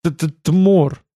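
teeth-chattering stutter; stuttering with fear. Acted. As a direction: stammer the name out, terrified. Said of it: "зубы отплясывали такую чечётку".

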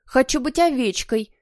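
nervous squeak. A narrator's reading. Nervous boy asks for sheep role, squeaking. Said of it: "пискнул нервный Ник".

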